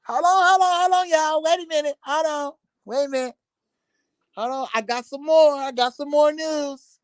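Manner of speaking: a lightly voiced eager gossip blogger; mock excited gossiper. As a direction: ham up a gossip who can't wait. Mock gossiper with more news.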